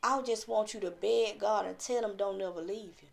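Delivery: ordinary talk, nothing marked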